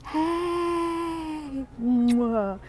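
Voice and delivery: squealed hello. Real, not acted. Host flipping camera to greet viewers, high squeal. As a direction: squeal hello then blow a kiss.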